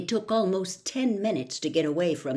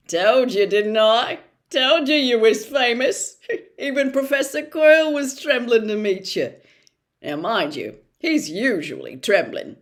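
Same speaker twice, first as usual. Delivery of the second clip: louder, with a booming laugh; proud giant teasing the boy; chuckling Hagrid; 2 semitones higher, 7 dB louder than usual. Acted. Hagrid teases Harry proudly.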